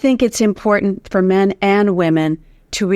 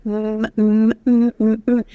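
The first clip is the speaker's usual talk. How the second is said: gentle humming; slower than usual. Shown as a demonstration. Coach showing listeners how to hum, gentle hum. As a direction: hum gently, lips touching. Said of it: "touch your lips very gently together and hum".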